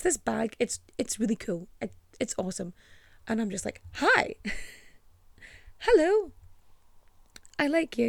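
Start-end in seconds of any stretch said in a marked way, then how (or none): 3.9-8.1 gushing high greeting. Retold or imitated. Gushing at the pink-suit woman.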